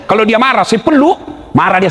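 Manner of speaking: ordinary delivery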